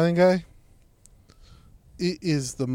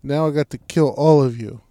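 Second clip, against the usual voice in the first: mock Italian mobster accent; 6 dB louder than usual. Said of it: "stereotypical Italian accent".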